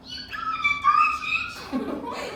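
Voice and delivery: real high-pitched laugh; high giggle. Real, not acted. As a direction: burst into giggles. Laughing at own performance.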